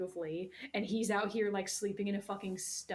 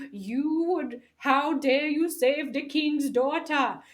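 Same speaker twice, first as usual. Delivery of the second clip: high, loud, put-on indignant old monkey voice; outraged Rafiki; 7 semitones higher, 12 dB louder than usual. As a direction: scold him in a shrill cartoon voice. Rafiki voiced scolding Kovu.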